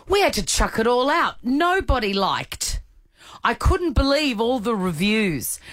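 building mock fury, ending high and shrill-free; mock outrage. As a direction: read it like a furious customer rant. Reading angry review.

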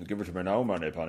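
lightly voiced bad film-star impression; low, half-hearted actor impression; Sean Connery. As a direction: do a bad Scottish spy, low. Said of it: "a really bad Sean Connery".

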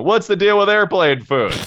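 high, loud bit-comic delivery; stand-up comedian voice. Acted.

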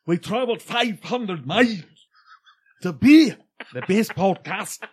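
hammed-up Scottish intro. Scottish podcaster proudly introducing his Oklahoma show, big Scottish brogue. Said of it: "Please intro the show like that".

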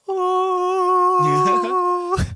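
very high, loud wailing moan; zombie moan. Retold or imitated. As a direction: wail it like a weird zombie. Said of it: "Hers was just like a".

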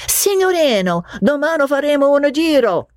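high sing-song Italian cab call, playfully imitated; mimicking Italian cabman. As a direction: echo the cabman's cry with a teasing lilt.

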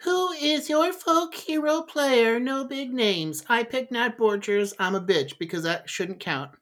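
high, slow reading voice; put-on reading voice. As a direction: read it out in a silly high voice. Reads listener question in voice.

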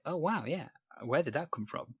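softly imitated pleasant surprise; mild surprise. Interviewer voicing a performer who surprises themselves, soft mock surprise. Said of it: "you surprise yourself in scenes".

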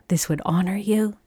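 soft whispered awe; whispered reply. Retold or imitated. Answering the surgeon who honored her, whispered. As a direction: breathe it out as a whisper.

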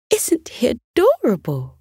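high, doting mother's voice; cooing mother. Acted. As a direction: coo over the little creature. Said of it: "said Elliot's mother".

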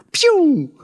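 high, loud "pew" zapping noise; sound effect. Shown as a demonstration. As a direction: fire off a high laser zap. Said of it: "a sound".

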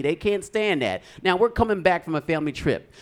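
usual speaking voice

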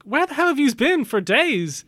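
high, loud, incredulous mock telling-off; exasperated police chief. Police chief to his returning officers, exasperated and incredulous. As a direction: imitate an outraged chief, pitch climbing sharply.

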